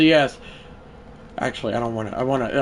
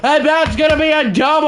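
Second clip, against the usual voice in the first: much higher, louder broadcast call; play-by-play call; 13 semitones higher, 6 dB louder than usual. Acted. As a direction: shout it like a live sports announcer. Calls his own at-bat.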